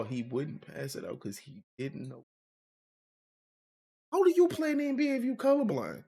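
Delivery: ordinary talk, nothing marked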